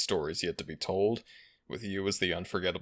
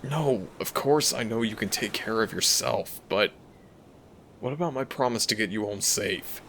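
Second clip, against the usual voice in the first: louder, urgent plea to a treasured human; protective dragon, worried; 2 semitones higher, 4 dB louder than usual. Acted. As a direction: play a concerned dragon shielding their companion. Dragon character worries over human companion.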